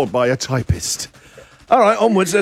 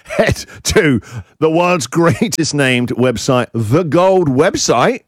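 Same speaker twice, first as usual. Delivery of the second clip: slow, grand announcer plug; 2 semitones higher, slower than usual. Acted. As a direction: sell it like an ad. Hyped website plug.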